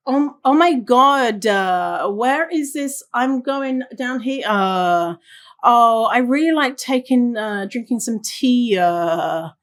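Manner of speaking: imitated Irish speech with dragged "uh" endings; teasing Irish impression, drawn-out endings; mock Irish speaker. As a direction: mimic an Irish accent, tacking "uh" onto phrases. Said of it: "So they'll be like".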